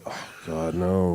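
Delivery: low, hushed dismayed groan; a mild mock cringe kept under the breath; inner groan. Dreading the speaker's microwave line, a hushed groan. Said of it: "I'm like".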